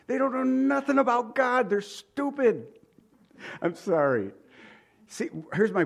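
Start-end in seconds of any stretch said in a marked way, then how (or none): 0.1-2.7 high-pitched, scornful exasperation; exasperated outburst. Real, not acted. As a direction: burst out high in blunt disdain. Outburst at know-it-alls, then apologizes.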